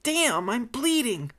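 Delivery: high, slow pained whine; sobbing. Acted. Bitten woman clutching her injury, wailing in pain. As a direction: wail it out as if hurt. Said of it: "The woman sobbed and pulled away".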